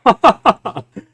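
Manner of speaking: loud, high burst of laughter; scornful laugh. Real, not acted. Vlogger answering his critic's charge, scornful laugh. Reacting scornfully to played clip.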